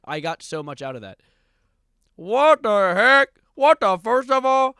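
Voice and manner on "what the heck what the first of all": high, slow, booming mock-kid yell; hammed-up loud imitation; mimicking catchphrases. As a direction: drag it out and shout it in mock bewilderment. Mimics the clip kid's catchphrases.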